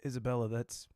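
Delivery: low, quiet, reluctant admission; sheepish confession. Retold or imitated. Confessing to the actress that he is the laugher, sheepish.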